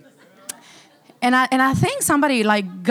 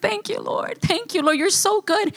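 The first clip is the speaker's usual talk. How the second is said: higher, softer prayer of thanks; praising; 6 semitones higher, 3 dB quieter than usual. Retold or imitated. Speaker retelling how she thanked the Lord, praising. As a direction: offer up gentle, raised-pitch praise. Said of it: "I started praising him".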